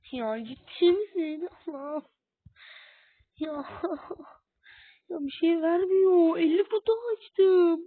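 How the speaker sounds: high, loud tearful wail; crying. Real, not acted. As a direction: wail in disbelief through tears. Breaks down over empty boxes.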